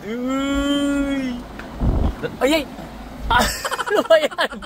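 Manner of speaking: high startled cry, half laughing; startled yelp. Real, not acted. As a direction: jump at a sudden lurch. Car lurches, coach startled. (nagulat na sigaw, kalahating tawa)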